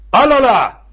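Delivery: high ringing shout back; rallying response cry. Acted. Children responding to the secretary, rallying cry. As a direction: answer the rally call in one burst.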